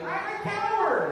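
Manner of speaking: very high, loud, slow cry; proclaiming cowardice. Acted. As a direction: announce it gleefully at high pitch.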